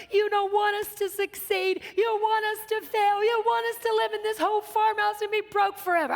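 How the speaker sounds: high, whiny stressed-out outburst retold; hammed-up meltdown. Stressed wife blaming her husband from the ditch, hammed-up meltdown. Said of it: "I'm having a meltdown".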